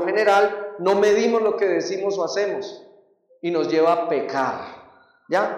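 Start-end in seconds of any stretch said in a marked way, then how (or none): none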